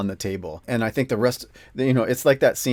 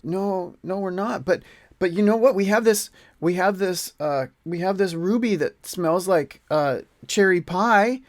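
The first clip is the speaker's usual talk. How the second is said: higher, hurried-to-explain adventurer voice; Keth; 9 semitones higher, 4 dB louder than usual. Acted. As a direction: haltingly placate the orcs in a raised pitch. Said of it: "steps up and goes".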